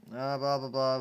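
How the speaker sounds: plain reading voice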